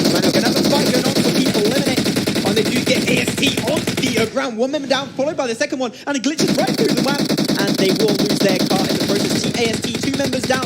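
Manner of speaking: high, loud, racing fight call; fast, high-energy shoutcast burst; excited play-by-play. Real, not acted. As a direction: ride the action as the kills land. Live fight call, big kills.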